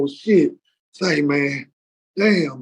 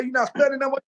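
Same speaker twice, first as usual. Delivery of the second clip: high, surprised question; 6 semitones higher, 4 dB quieter than usual. Acted. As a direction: ask it amazed. Wife surprised in joke.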